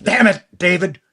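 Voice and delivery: high, gruff snarl of the first Freddy voice; guttural Freddy voice. Retold or imitated. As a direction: snap it out in a guttural growl. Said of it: "it was kind of a guttural".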